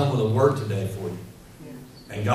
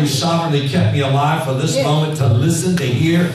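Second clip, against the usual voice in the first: louder, building preached prayer; fervent prayer; 2 semitones higher, 5 dB louder than usual. Shown as a demonstration. Preacher modelling a prayer for the congregation, loud and fervent. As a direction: pray it out with rising fire. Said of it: "So learn to say".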